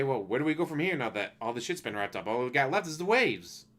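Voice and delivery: usual speaking voice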